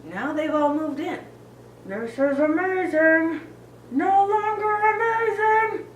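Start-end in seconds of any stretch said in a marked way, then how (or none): 1.8-3.4 high, loud thrilled cry; exhilarated shout. Acted. Rider exclaims how amazing this is, thrilled. 3.9-5.9 very high, loud sustained cry; panicked scream. Acted. Rider finds it no longer amazing, panicking.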